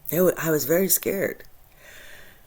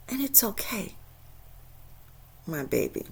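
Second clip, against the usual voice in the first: soft whisper, gentle; aunt whispering comfort to her niece; tender comfort; 6 semitones higher, 9 dB quieter than usual. Retold or imitated. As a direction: soothe her like a child.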